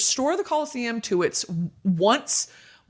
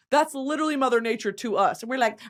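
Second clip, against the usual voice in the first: ordinary talk, nothing marked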